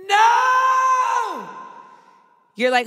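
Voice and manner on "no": high anguished scream of no; a sudden high wail of despair. Retold or imitated. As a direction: shriek it like watching him die. Rickon dies on rewatch.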